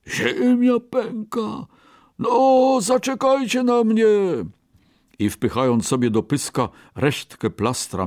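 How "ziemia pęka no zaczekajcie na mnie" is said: the bear catches the panic and runs; panicked bear. Acted. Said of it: "zawołał trzeci niedźwiedź". (wysoki, przestraszony okrzyk niedźwiedzia)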